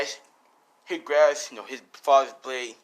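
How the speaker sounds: usual speaking voice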